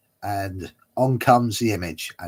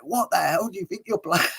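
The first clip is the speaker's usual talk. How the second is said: high, laughing mock outrage; mock outburst; 9 semitones higher than usual. Taxi driver exploding at the actor, laughing mock outburst. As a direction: imitate exploding, cracking up as you go. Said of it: "the taxi driver exploded".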